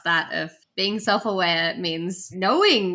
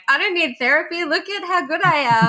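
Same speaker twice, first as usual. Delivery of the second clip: high, loud boastful brag; hammed-up smug patient; mock cocky self; 7 semitones higher, 4 dB louder, faster than usual. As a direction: brag like you don't need therapy. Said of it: "You're in there being like".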